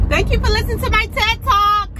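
high, laughing mock speech close; laughing through a fake TED Talk sign-off; mock formal thanks. Real, not acted. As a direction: thank them like a joking TED speaker.

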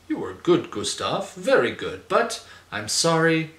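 lilting tutor with big pitch swoops. Acted. Tutor appraising pupil Gustav's playing, lilting schoolteacherly praise. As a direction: praise in exaggerated swoops, leading up to a letdown. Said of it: "My tutor says".

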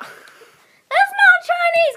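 high, loud, drawn-out objection; loud protest. Real, not acted. Player disputes friend's Chinese accent, loud protest.

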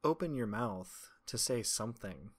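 ordinary delivery

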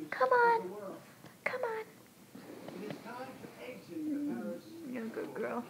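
soft whispered coaxing to a dog. Real, not acted. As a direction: whisper come on to the puppy.

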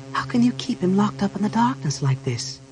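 high-pitched woman's reproach; dismayed priestess. Acted. Chrysania reproaches Raistlin.

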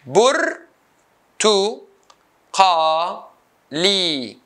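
"burtuqali" as bur, tu, qa, li with pauses; syllable by syllable. Shown as a demonstration. Teacher cutting the word into four, syllable by syllable. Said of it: "four syllables".

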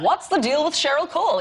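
a hint of a brassy comic voice; stand-up bit. Comic recalling her gig with the baby, mild stand-up voice. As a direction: toss it out like a stand-up opener. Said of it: "I was like".

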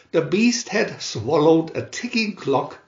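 plain reading voice

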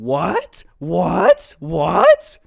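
mock frantic mom, rising each time. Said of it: "my mom very frantically going".

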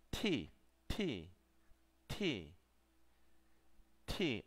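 "T" repeated as "tee", each with a puff of air; separate, spaced-out English-style "T" demos; aspirated T. Shown as a demonstration. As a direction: pop each "T" open with pushed breath. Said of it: "Así es más o menos como suena".

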